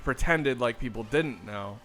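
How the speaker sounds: ordinary talk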